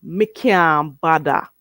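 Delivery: slow Jamaican "Me can't bother", like "mi kyaahn badda"; Jamaican Creole. Shown as a demonstration. Teacher showing viewers how you say it, slow Jamaican Creole. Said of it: "this is how you say it".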